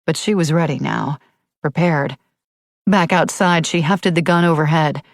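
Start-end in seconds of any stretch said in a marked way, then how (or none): none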